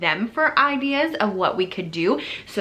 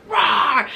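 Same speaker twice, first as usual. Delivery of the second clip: loud, higher playful roar; bear roar; 6 semitones higher, 5 dB louder than usual. Retold or imitated. Children answer with a bear noise, loud roar. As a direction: roar like kids playing bears. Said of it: "what kind of noise does a bear make".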